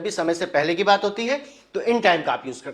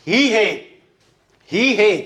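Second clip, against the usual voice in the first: ही raised and punched; stress on ही; 3 semitones higher than usual. Shown as a demonstration. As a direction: punch ही each time. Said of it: "Hindi में ही पर जोर दे रहे हैं". (ही पर ज़ोरदार जोर)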